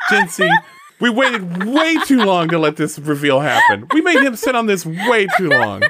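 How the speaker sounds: high, loud talking through laughter; real laughing. Real, not acted. Player cracking up at the long-delayed reveal, laughing. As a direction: crack up while getting the words out.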